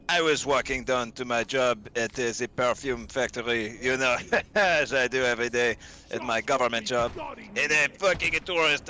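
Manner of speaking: put-on comic French accent, improvised with a giggle. Frenchman recounting his walk to work, French accent. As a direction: try on a Frenchman telling an anecdote in French accent. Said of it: "if I'm doing a French accent".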